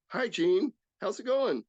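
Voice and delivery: high, bright hi; cheery greeting. Retold or imitated. Greeting Jean from his pickup, cheerful and high.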